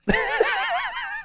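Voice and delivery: high burst of laughter. Real, not acted. Caster laughing at his own misclick, high laugh.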